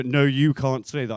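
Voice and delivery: loud, slow, with YOU punched; insistent mock rebuke. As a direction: hit "you" hard as an imitated correction. Girl insists, correcting him.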